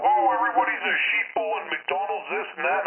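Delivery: high, sneering mock ranting voice; mocking a ranter. Mocking the conspiracy guy on the radio, sneering.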